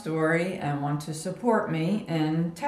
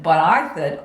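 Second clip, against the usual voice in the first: ordinary talk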